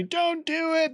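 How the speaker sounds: very high-pitched mock scream, held back in volume; imitated scream. Ghost screaming at the girl, high mock yell. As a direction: squeal it as a thin imitation scream. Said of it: "he just screams at her so loudly".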